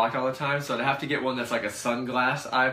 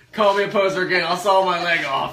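mock threat; 9 semitones higher, 10 dB louder than usual.

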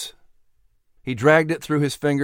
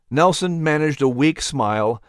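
ordinary delivery

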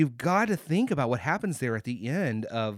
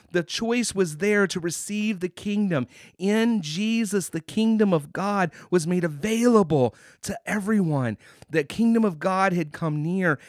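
louder, higher preaching build; rising preaching; 4 semitones higher, 4 dB louder than usual. Real, not acted. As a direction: press the point home from the pulpit. Preacher rising to full force.

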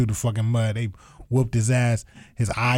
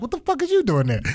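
incredulous quote; 11 semitones higher than usual. Retold or imitated. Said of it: "looked at me like".

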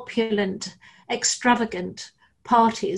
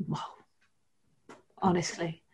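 soft, breathy whisper; 4 semitones lower, 9 dB quieter than usual. Real, not acted. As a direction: hush it like a held breath. Pin-drop silence moment.

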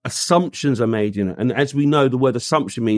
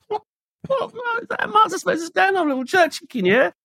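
incredulous. Real, not acted. To the listener about M&S jerk chicken, incredulous. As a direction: squeak out the question in disbelief.

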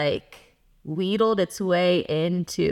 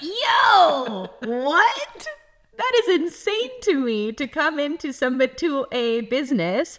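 incredulous outrage; 7 semitones higher than usual. Retold or imitated. Inner rant at rude customer.

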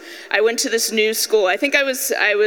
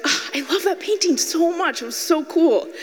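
higher-pitched swooning gush; 8 semitones higher than usual. Retold or imitated. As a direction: gush with delight over something you adore. Gushing over a beloved painting.

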